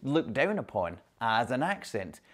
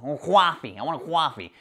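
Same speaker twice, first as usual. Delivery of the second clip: higher-pitched, nasal New Yorker; nasal New York accent; 3 semitones higher, 4 dB louder than usual. Retold or imitated. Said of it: "being a wee bit nasal".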